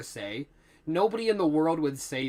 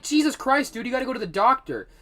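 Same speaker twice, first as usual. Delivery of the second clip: higher, fast exasperated plea; exasperated urging; 7 semitones higher, 4 dB louder, faster than usual. Retold or imitated. Worker begging janitor to see doctor, exasperated. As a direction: urge him, half shocked. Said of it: "I was like".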